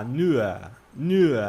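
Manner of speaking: "nur" ending in an A sound; "nur" with the R softened into a vowel. Shown as a demonstration. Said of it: "o R ali é meio que um A".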